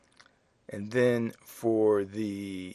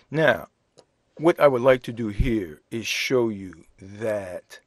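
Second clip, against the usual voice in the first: usual speaking voice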